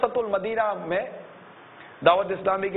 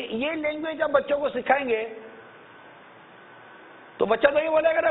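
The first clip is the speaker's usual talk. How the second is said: usual speaking voice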